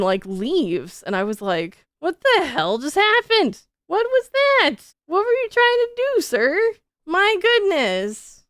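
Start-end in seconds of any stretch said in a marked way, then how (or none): 2.0-8.4 much higher and louder, incredulous; shocked outburst. Retold or imitated. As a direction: squeal it in total disbelief. Retold shock after intruder leaves.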